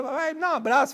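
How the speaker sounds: high-pitched imitation of a little girl; child pleading. Daughter asks busy father for a hug, high pleading child voice. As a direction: beg daddy like a small child.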